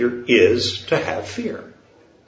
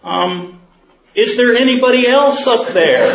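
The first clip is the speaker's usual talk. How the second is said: much higher and louder, pleading; desperate man; 9 semitones higher, 3 dB louder than usual. Acted. Man on the cliff asks for someone else, high and desperate. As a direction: squeal it out, comically desperate.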